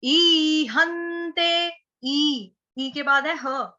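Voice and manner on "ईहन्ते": ईहन्ते slowly with a held long ई and higher pitch. Shown as a demonstration. Teacher drawing out the long ई of ईहन्ते. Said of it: "ईख वाली".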